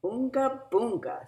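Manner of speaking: put-on loud, high chorus of baby talk; everyone together. A narrator's reading. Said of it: "said everyone in unison".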